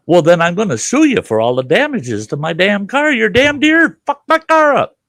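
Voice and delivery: high, loud indignant rant; outraged threat. Retold or imitated. To the DNR warden over his deer, a loud outraged threat. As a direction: rail at him, outraged.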